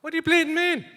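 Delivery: loud, high Dublin outburst; Dublin accent. Acted. Co-host challenged about his accent, indignant high Dublin accent. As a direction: exclaim it in a broad Dublin accent. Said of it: "speaking with a accented Dublin accent".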